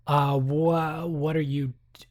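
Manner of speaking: ordinary delivery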